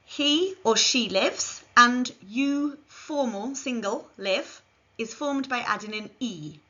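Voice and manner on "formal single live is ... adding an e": normal talking voice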